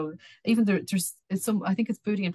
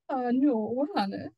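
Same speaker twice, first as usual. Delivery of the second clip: higher-pitched, coaxing plea; wheedling; 6 semitones higher, much slower than usual. Acted. Little man in the tree pleading with his wife, wheedling. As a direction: wheedle gently to get round her. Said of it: "the little man wheedled".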